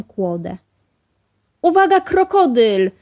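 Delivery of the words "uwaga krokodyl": high, loud alarmed shout; exclaiming. A narrator's reading. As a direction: warn everyone at the top of the voice. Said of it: "wykrzyknął". (głośny, wysoki okrzyk ostrzeżenia)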